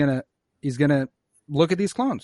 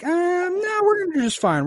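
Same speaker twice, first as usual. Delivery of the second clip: dismissive reply; 13 semitones higher than usual. Acted. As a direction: wave it away on a long high note. Acted dismissive reply to Prime Minister.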